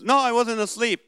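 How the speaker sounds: high-pitched mock protest from the wife; indignant denial. Wife just woken in the car, indignant denial. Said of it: "But then she goes".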